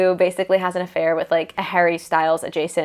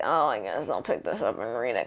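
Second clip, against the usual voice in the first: low, soft and bored, like a shrug; a put-on mumble of someone not excited to read; unenthused mock voice; 8 semitones lower, 9 dB quieter than usual. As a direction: mimic a reluctant reader, much lower and softer. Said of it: "it's like".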